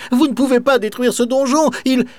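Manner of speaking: little boy insisting, voiced high and pressing. A narrator's reading. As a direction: plead with the baron to spare the tower. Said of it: "et insiste".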